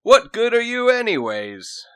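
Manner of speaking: loud, high-pitched yell; shouting. Acted. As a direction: yell it at the useless dog. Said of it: "Toby shouted".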